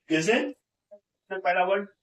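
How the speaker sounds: normal talking voice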